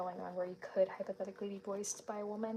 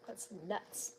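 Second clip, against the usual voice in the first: ordinary talk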